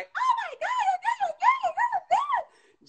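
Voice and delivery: very high excited squeal; too-high greeting. Shown as a demonstration. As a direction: shriek it in a sky-high voice like a thrilled fan. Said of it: "not that high".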